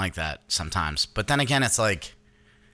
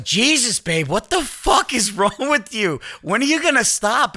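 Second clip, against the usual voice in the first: high, slightly louder mock outrage; exasperated wife imitation; 12 semitones higher, 4 dB louder than usual. Wife fed up with husband's endless pee, high mock exasperation. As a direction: stretch it out in hammed-up exasperation.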